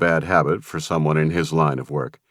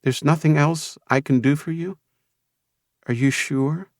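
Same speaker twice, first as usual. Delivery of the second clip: seductive, expectant; 9 semitones higher, 3 dB quieter than usual. A narrator's reading. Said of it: "something almost seductive and expectant in her voice".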